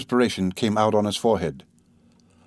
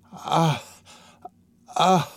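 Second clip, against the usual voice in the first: high strained groans of a dying man; pained, uneasy ah sounds; breathy pained cries; uneasy cry; 6 semitones higher than usual. A narrator's reading. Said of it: "he signed towards her uneasily".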